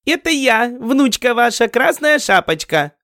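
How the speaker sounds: put-on high squeaky little-girl voice. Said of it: "Писклявым голосом отозвалась ведьма". (писклявый детский голосок)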